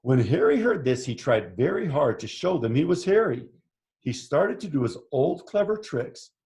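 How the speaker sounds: animated picture-book narration; story read to children. A narrator's reading. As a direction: read it out with lively swoops for young listeners. Reads picture book aloud.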